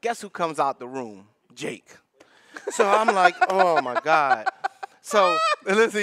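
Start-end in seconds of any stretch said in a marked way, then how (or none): 2.7-6.0 loud laughing burst; cracking up, loud and high. Real, not acted. Telling friends about Jake, loud laughing.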